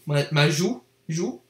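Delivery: ordinary talk, nothing marked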